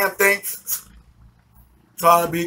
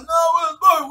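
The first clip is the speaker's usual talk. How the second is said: acted giddy reply; 9 semitones higher, faster than usual. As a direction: answer high, as if stoned and giddy. High, acted answer to mother-in-law.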